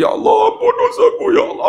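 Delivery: high, hammed-up tearful plea; imitated crying. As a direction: mimic a pilgrim sobbing for forgiveness. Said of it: "dia teriak". (merayu sambil menangis olok-olok)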